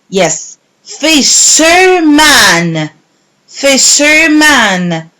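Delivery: slow, split: Fish-er-man; syllable by syllable. Shown as a demonstration. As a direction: break Fisherman into its parts. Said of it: "match the syllables".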